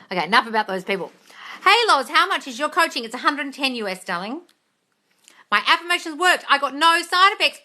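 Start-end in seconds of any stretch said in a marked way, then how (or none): none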